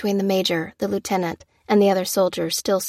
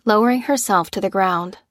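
plain reading voice

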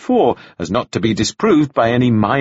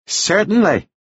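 higher-pitched, courteous assent; 8 semitones higher, slower than usual. A narrator's reading. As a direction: agree politely as the reverend. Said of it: "said Carol Devlin with courtesy".

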